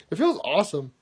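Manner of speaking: usual speaking voice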